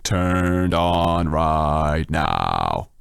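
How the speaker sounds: extremely slow, stretched-out words. Pretend. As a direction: drag every word out to show off the voice. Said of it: "The deep voice is turning on slightly".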